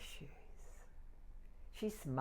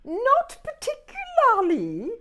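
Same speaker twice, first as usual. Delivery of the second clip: high loud haughty reply; dismissive; 18 dB louder than usual. Acted. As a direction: brush it off with disdain. Cat answers dismissively.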